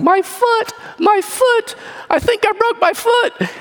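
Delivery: mock pained yell. Guy on the ground after Jeep ran over his foot, high mock yell. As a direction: imitate a hurt man crying out. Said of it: "on the ground yelling".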